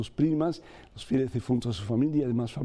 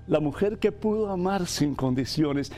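heartfelt prayer; 4 semitones higher than usual. Acted. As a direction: pray earnestly to God, phrase by phrase. Leads a prayer aloud over music.

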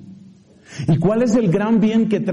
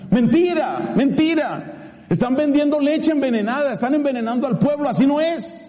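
higher, forceful denouncing; indignant denial; 6 semitones higher than usual. Real, not acted. Preacher to the congregation, indignant outcry. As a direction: condemn it like a prophet.

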